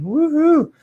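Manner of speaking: a high, whooping cheer; cheeky woohoo after a joke; playful woohoo. Real, not acted.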